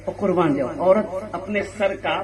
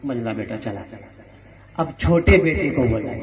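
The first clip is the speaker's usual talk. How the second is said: ordinary talk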